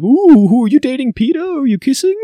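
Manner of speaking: mock a giggly gossip prying about a crush; mocking Capitol gossip. Mocking how Capitol people tease her about Peter, in a high falsetto.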